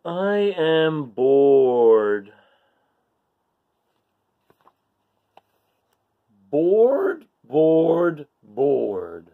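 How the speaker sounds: slow, drawn-out bored moan; bored barnacle. Acted. Barnacle complains to readers, slow and bored. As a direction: sound utterly fed up with life.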